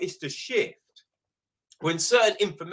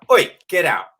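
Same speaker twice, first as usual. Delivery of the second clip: louder snapped order; blunt ejection; 9 dB louder than usual. Retold or imitated. Usher ordering a rude moviegoer out, loudly. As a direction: throw them out. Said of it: "it was just like".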